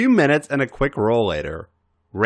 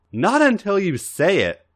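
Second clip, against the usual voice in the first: higher, firm refusal; a lightly voiced stubborn hold-out; 11 semitones higher than usual. A narrator's reading. Raven refusing A J until he says it, stubborn. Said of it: "he reiterated stubbornly".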